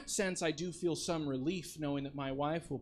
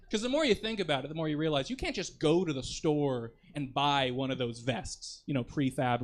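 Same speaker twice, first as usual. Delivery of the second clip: normal talking voice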